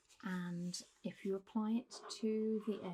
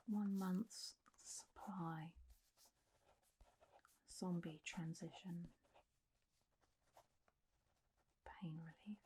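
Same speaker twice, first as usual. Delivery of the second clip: slow, trailing off quieter; writing-aloud murmur; 5 dB quieter than usual. Acted. Doctor writing a prescription for pain relief, murmuring slowly to herself. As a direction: say it piece by piece as you write.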